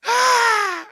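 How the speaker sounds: sudden high scream of frightened men; hammed-up terrified yell; mock scream. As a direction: shriek like a panicked fisherman. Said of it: "el montón de hombres ahí gritando".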